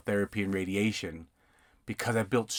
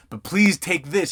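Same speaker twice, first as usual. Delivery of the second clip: higher, slow, louder little plea; mock pleading; 6 semitones higher, 5 dB louder, slower than usual. Escrow officer pushing a ream on the notary, pleading. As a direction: beg him to take it, hammed-up.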